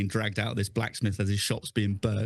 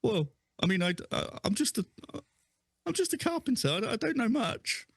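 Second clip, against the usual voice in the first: much higher, stammering and squeaky; nervous pleading; 10 semitones higher than usual. Acted. Dangled carpenter pleads with his captor, squeaky and nervous. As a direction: squeak out your excuses while dangling.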